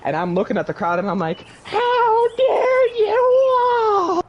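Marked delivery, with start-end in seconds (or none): 1.6-4.2 high, very slow, loud wail; mock outrage. Humiliated fighter to laughing crowd, high outraged shout. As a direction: stretch every word in wounded fury.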